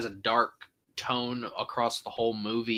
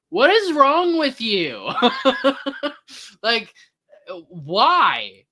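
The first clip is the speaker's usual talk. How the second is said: much higher and louder, slow, incredulous; exasperated outburst; 10 semitones higher, 7 dB louder, much slower than usual. Real, not acted. Fan outraged at a director's recasting, exasperated outburst. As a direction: demand it of the director in disbelief.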